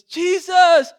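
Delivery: loud, high cry of grief; Mary crying out to Jesus over her dead brother; anguished cry. Retold or imitated. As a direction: call out Jesus's name in anguish. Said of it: "And Mary says".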